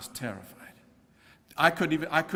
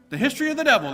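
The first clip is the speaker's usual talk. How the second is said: mock documentary title; 10 semitones higher, 6 dB louder, much faster than usual. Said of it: "they call it, right?".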